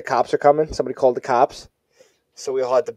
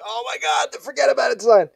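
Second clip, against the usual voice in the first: excited; 10 semitones higher than usual. Retold or imitated. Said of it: "We were like".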